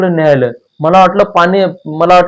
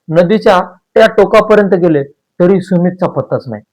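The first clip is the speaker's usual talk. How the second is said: plain reading voice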